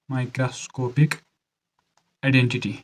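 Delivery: stretched out, a pause between words; measured, word by word; slow dictation. Shown as a demonstration. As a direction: dictate it slowly for note-taking. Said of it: "धीरे से बोल रहा हूँ".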